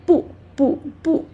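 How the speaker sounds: short, separate 不 each falling. Shown as a demonstration. Teacher drills 不 alone, each one falling. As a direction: drop the pitch on every 不. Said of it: "alone, falling tone".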